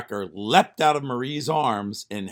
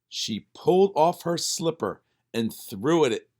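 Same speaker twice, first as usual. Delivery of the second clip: plain reading voice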